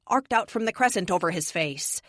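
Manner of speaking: ordinary delivery, nothing marked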